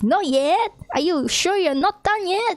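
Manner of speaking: high-pitched incredulous complaint; mock super-angry. Visitor badgering doorbell guy, mock angry. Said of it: "he got, like, super angry".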